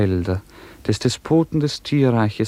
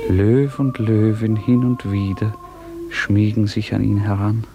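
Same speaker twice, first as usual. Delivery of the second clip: measured, lilting verse recitation; recited poem. A narrator's reading. Narrator reciting the child's song to the listeners, measured and lilting. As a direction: let the hymn verses flow gently, line by line.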